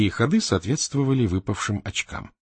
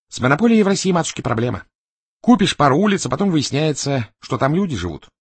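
higher, louder animated board-game player; Березовский; 6 semitones higher, 6 dB louder than usual. A narrator's reading. Complaining to his opponent over the Monopoly board, animated. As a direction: pitch it up, loud and lively.

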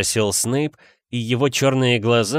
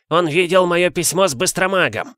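high, agitated accusation; возмущённый обвинитель; 7 semitones higher, 3 dB louder than usual. Acted. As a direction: keep accusing, voice pitched up. Филч продолжает кричать, обвиняя. (возмущённо, высоким голосом)

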